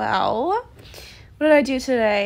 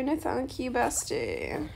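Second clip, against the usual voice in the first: ordinary talk, nothing marked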